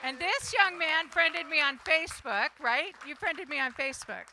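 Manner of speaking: loud friendly teasing; playful call-out. Real, not acted. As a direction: tease him in front of everyone. Host teasing newcomer loudly.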